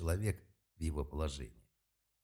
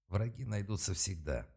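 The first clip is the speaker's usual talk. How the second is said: ordinary delivery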